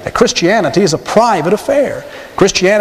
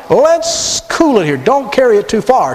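higher, slow, coaxing hush-hush tone; mock caution; 6 semitones higher, slower than usual. Preacher voicing the tempter's caution to the congregation, higher and slow. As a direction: hold them back with a sly, higher caution.

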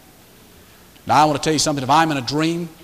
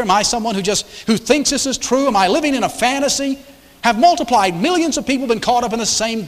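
preaching at full force; 7 semitones higher than usual. Real, not acted. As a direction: build the challenge like a revival preacher. Rising rhetorical challenge to congregation.

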